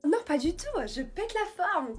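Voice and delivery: loud, high and slow fake pep; bouncy showy energy for the guests; overdone enthusiasm. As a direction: oversell how wide awake you are. Faking energy for the boys.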